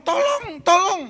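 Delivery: high desperate cry for help, tolong; drowning man's cry. Retold or imitated. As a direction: call out like someone drowning. Drowning man cries for help. (teriakan minta tolong orang tenggelam)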